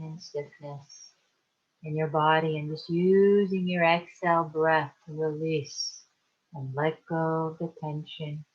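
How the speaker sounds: calm, unhurried meditation guide with long pauses; guided meditation. Acted. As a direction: ease them into relaxation, leaving space to breathe. Leads a guided relaxation meditation.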